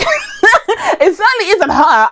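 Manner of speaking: high, slow, drawn out through laughter; laughing emphasis. Real, not acted. Reviewer cracking up over the sidelined Wasp, laughing emphasis. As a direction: laugh while stretching out "her".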